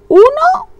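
uno squeaked way up high; sing-song high uno. Real, not acted. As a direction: toss the word up playfully. Counting leftover stitches playfully.

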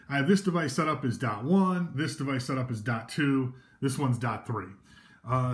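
normal talking voice

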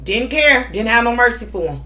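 ordinary delivery

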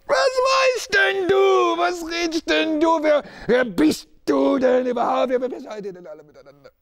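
defiant shout. Acted. Accused man shouting at his accusers. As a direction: throw the question back at them. Desperate outburst against accusers.

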